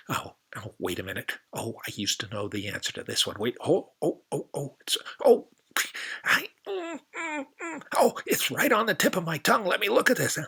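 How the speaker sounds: a muddled creature straining to remember; dithering anteater. Acted.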